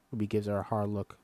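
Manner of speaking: plain reading voice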